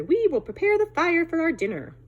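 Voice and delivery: high, loud excited cubs; little foxes cheering together; eager little foxes. Acted. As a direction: chirp it like hungry pups. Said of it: "said all together".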